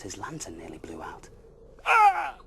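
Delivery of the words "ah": very high loud startled shriek. Acted. Bear hit in the face by an owl, shrieking. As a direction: shriek as something hits your face. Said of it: "shrieked Mister Bear".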